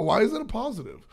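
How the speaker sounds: high, incredulous question. Retold or imitated. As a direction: squeak it out in disbelief. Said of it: "I was just like".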